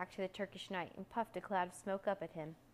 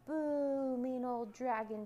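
high, loud, long boo; children's shouted jeer; 6 semitones higher, 3 dB louder, slower than usual. A narrator's reading. Children boo the dragon, high shouted jeer. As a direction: jeer like children booing a villain. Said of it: "shouted the old woman's children".

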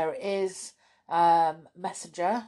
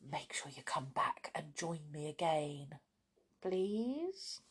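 whispering; 9 dB quieter than usual. Real, not acted. Whispers the sign-off.

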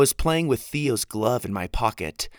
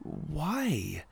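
hushed, softer anxious question; nervously; 4 semitones higher, 9 dB quieter than usual. Acted. Winnie to Jack fearing Luke's reason, nervous hush. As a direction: ask it almost afraid of the answer. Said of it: "I asked nervously".

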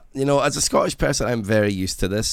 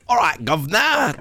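mock Cockney; 10 semitones higher, 4 dB louder than usual. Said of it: "be like".

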